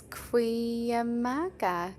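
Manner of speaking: Cuyamaca drawn out very slowly, syllable by syllable; careful pronunciation. Shown as a demonstration. Speaker corrects friend on the trail's name, Cuyamaca pronounced slowly and carefully. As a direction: stretch out every syllable of Cuyamaca. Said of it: "it rolls off the tongue better".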